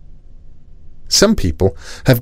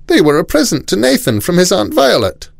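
high-pitched questioning grown-up; neighbour's mother; 11 semitones higher than usual. A narrator's reading. Nathan's mother quizzing the boy at the door, high-voiced. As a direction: pitch it well up, quizzing the child.

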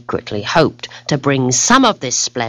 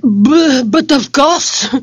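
flustered stammer; 12 semitones higher, 4 dB louder, slower than usual. Acted. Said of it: "replied the discombobulated marquis".

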